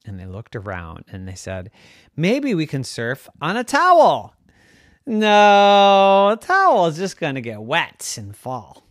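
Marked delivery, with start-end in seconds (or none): 2.2-8.8 put-on higher, louder kid voice; excited kid ideas. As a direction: pitch ideas like a bouncy child. Kids brainstorming surfboards.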